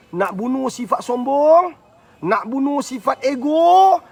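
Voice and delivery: slow, high, drawn-out shouts; rousing declaration. Shown as a demonstration. Preacher vowing to kill off vices, slow rising shout. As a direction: shout each phrase, stretching the last word. Demonstrating a rousing self-cleansing chant.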